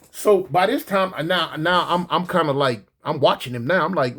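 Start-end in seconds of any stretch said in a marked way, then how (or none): none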